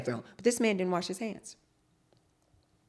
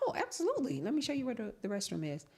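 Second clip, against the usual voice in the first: sweet high hostess; 6 semitones higher, 7 dB quieter, much faster than usual. Retold or imitated. Retold reply to contractor.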